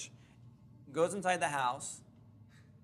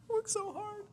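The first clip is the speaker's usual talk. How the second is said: near tears; 4 dB quieter, slower than usual. Retold or imitated. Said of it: "about ready to cry".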